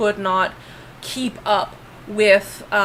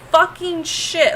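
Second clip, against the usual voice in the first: normal talking voice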